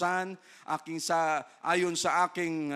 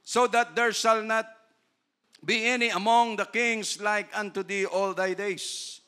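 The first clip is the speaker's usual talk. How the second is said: slow, loud and high; verse on riches and honor, slow and loud; scripture reading; 4 semitones higher, 6 dB louder, slower than usual. Acted. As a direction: declaim it slowly. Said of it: "quotes scripture".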